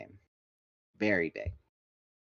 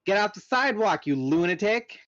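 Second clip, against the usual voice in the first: angry yell; 10 semitones higher, 6 dB louder than usual. A narrator's reading. Yelling at another driver.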